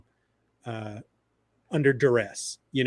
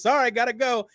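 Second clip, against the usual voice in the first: hurried excuse; 8 semitones higher, faster than usual. Retold or imitated. Superman's hasty exit acted out.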